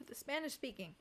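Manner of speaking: exhausted, quiet retake; weary sigh. Real, not acted. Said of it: "repeated after sigh".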